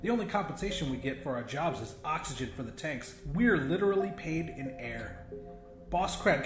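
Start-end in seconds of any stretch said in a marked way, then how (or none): none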